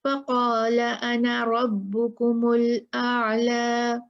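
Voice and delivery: फ़क़ाल अना रब्बुकुमुल आला in one slow flow, the alif of अना short; don't stretch अना, flow on to रब्बुकुमुल; continuing the verse without the long alif; recited continuously. Shown as a demonstration. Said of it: "फिर हम नहीं पढ़ेंगे".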